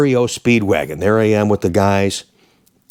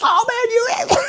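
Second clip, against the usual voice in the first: high-pitched mock shriek of an annoyed fan; angry fan shriek; slower than usual. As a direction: shriek at him, miffed she lost the stick. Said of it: "She goes".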